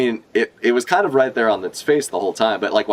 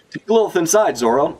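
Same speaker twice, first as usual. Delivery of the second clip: urgent order; 8 semitones higher than usual. Acted. Re-reading the order.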